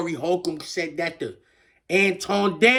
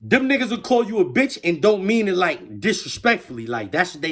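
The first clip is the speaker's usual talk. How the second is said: ordinary delivery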